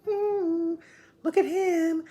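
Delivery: very high mock cooing; cooing onlookers. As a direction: imitate onlookers in a squeaky high voice. Onlookers voiced in a story.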